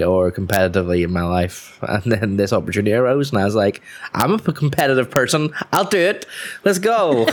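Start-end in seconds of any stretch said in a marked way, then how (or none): 4.1-7.3 high-pitched, gung-ho volunteering; retold eager excitement. Recalling signing up for the eFootball team, excited. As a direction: jump at the chance, pitch climbing high. Retells jumping at the team opportunity.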